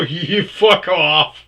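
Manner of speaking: slow, loud, high fuck off; slow angry retort. Retold or imitated. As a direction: drag it out with contempt. Retold telling coworker off.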